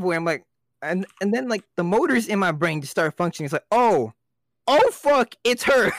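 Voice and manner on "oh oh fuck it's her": retold shock, rising higher and louder; dawning alarm. Storyteller reliving spotting the ex at work, alarmed realization. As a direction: act out the penny dropping. Acts out sudden recognition of ex.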